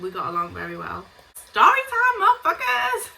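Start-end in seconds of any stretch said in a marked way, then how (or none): 1.5-3.1 hyped shout. Real, not acted. Opening the story to viewers, hyped high shout. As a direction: belt it out to kick off story time.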